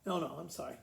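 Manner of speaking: ordinary delivery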